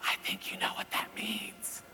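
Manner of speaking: pointed stage whisper. Retold or imitated. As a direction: whisper it knowingly, imitating a daughter. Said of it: "she answers the phone whispering".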